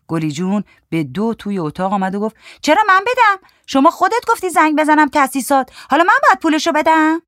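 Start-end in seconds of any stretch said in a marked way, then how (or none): 2.6-7.2 high, loud indignant protest; indignant woman. A narrator's reading. Woman refusing to pay the workmen, indignant high voice. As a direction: argue back in a very high woman's voice. Woman quarrels over who pays.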